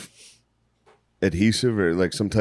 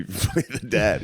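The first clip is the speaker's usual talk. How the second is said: ordinary talk, nothing marked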